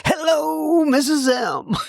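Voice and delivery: high, bright mock announcer hello; silly announcer greeting. Shown as a demonstration. Host mocking himself greeting his wife in his announcer voice. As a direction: greet her like a radio host, hammed-up. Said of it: "That would be kinda silly".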